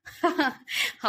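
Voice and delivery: short high chuckle; laugh, pleasantly amused; happy laugh. A narrator's reading. Friend laughs at the fear of her, happy chuckle. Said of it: "மகிழ்வுடன் சிரித்தான்".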